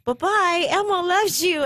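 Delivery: high-pitched puppet farewell; lilting, high puppet voice; Elmo. Retold or imitated. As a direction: imitate a children's TV puppet saying goodbye. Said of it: "to Elmo saying".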